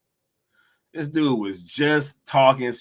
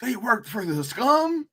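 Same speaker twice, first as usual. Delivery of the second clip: mock disbelief; 9 semitones higher, 3 dB quieter than usual. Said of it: "all of a sudden".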